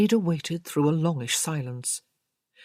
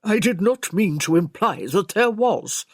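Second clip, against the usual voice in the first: louder, affronted servant; bridling; 3 semitones higher, 5 dB louder than usual. A narrator's reading. Servant denying he implied any scandal, bridling. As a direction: protest the suggestion, offended. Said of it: "Conway bridled".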